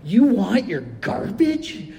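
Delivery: slow, drawn-out disbelief; puzzled worker asking slowly; incredulous question. Retold or imitated. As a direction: ask it like he can't believe it. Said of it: "he was looking at it like".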